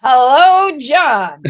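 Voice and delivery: loud, high, grand hello; big hello. Acted. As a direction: greet him with a big splashy hello. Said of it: "I should have a bigger hello".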